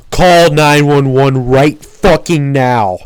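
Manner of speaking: loud, slow, urgent yell; retold yell. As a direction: bark the order to call for help. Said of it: "He yells at J T".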